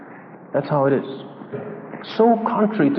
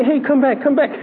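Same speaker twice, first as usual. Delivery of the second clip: mock pleading call; 8 semitones higher than usual. Jesus calling back the leaving disciples, mock pleading. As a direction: call after them, begging them back. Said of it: "he didn't say".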